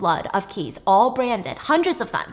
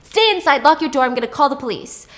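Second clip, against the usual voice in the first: alarmed shouting; 6 semitones higher, 7 dB louder than usual. Retold or imitated. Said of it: "And he's like".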